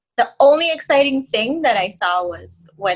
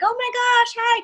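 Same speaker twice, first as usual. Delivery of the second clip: high excited greeting; excited high squeal; 12 semitones higher than usual. Retold or imitated. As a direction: squeal it with glee, pitched way up. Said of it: "I was like".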